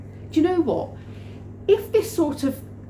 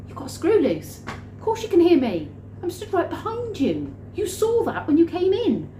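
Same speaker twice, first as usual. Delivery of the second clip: acted exasperation; 9 semitones higher than usual. Her acted exasperated thoughts.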